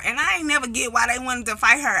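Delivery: normal talking voice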